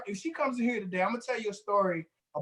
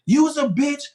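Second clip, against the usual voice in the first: high, louder mock yell of an angry man; loud insult; 6 semitones higher, 7 dB louder, slower than usual. Angry client at the guard, louder mock yell. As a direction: hurl it like an irate client yelling. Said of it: "So he talking loud".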